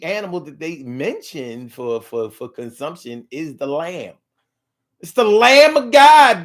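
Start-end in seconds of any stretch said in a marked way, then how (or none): 5.0-6.4 loud, high, slow proclamation; preacher shout. Acted. Preaching burst on Lamb of God.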